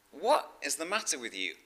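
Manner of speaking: rising to a high, loud incredulous demand; mock exasperation. Woken father-in-law answers a night caller, acted exasperation. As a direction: snap it like someone just woken up. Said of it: "a very polite man".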